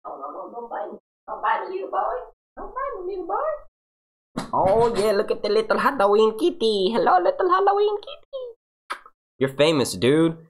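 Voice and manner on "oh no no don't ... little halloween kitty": very high, squeaky cooing to a kitty. Real, not acted. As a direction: coo sky-high at your little cat. Baby-talking to his cat.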